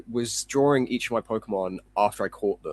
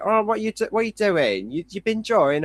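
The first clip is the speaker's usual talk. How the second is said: higher-pitched mum asking nosy questions; imitating a curious mum; prying mum; 9 semitones higher, 4 dB louder than usual. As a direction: put on an inquisitive mother's voice, pitched up. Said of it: "your mum's asking you".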